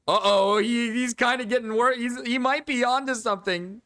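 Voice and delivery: high, loud nervous uh-oh; rising alarm as the monkey gets bold; high startled commentary; alarmed high excitement. Real, not acted.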